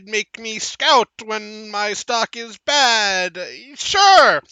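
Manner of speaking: slow, drawn-out, hedging admission; reluctantly confessing halfling. Acted. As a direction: drag the confession out grudgingly.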